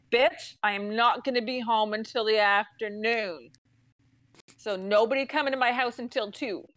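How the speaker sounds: firm, word-by-word telling off; retold blunt insistence. Customer to the girl on the phone, firm insistence. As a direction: spell it out firmly, no hurry. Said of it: "this is me to this girl".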